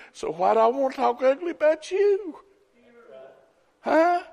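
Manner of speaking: high, climbing rhetorical question; questioning challenge. Real, not acted. Preacher challenges Alan about ugly talk, questioning. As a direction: push the pitch up, demanding an answer.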